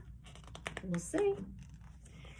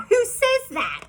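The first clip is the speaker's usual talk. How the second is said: very high astonished question; mock disbelief; 10 semitones higher, 4 dB louder than usual. Teacher to the kids, high mock disbelief. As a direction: feign being scandalised.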